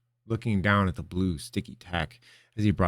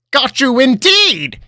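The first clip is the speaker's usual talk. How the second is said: high, slow, cackling triumph; 13 dB louder, slower than usual. Acted. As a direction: crow it with a cackle. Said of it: "he cackled".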